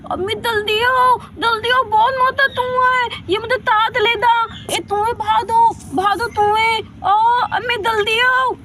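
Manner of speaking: loud, high tearful cries for help; panicked crying plea. Acted. Rani cries to her mother about the fat mouse, high, loud and tearful. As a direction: wail for mother to come quickly.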